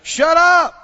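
loud, high shout of shut up; shouted rebuke. Shown as a demonstration. Preacher showing the church how to rebuke doubt, shouting. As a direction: shout them down hard. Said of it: "open your mouth and say".

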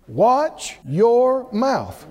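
"Watch your mouth" drawn out slowly, each word weighted; slow and deliberate. Real, not acted. Preacher giving the congregation the key instruction, slow and deliberate.

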